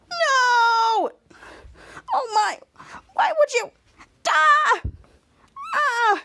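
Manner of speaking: very high, betrayed cries of pain; acted agonized wailing. Ray maced by his new friend, high hammed-up wailing.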